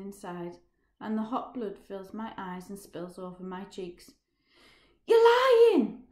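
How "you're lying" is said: very high, very loud cry; desperate shout at a friend walking away. Acted. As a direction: scream it after him in disbelief. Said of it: "I shout".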